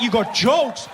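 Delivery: high-pitched, loud comic outrage; outraged shouting. Retold or imitated. Son scolds pharmacist for joking about his mother, outraged shout. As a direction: protest at the top of your voice.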